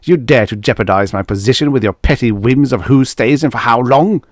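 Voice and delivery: loud, fast furious scolding; husband berating wife in fury; furious tirade. Acted. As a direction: rage at her, quick and loud.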